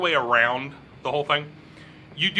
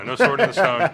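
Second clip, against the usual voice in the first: laughing; 2 semitones higher, 7 dB louder than usual. Real, not acted. GM laughs at player's joke.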